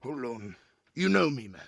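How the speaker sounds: indignant regular at the gate; armored giant Glurg. Acted. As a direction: grumble while searching, then protest. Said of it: "Glurg fiddles about in a pocket".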